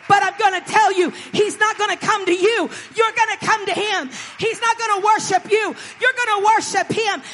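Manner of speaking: loud, high revival-style preaching; full-force preaching. Real, not acted. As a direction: drive each line home like a preacher.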